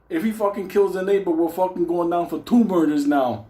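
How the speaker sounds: ordinary delivery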